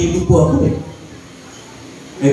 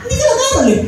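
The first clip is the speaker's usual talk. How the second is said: much higher, laughing through the words; laughing tease; faster than usual. Real, not acted. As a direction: tease the student while laughing. Pastor teasing the student.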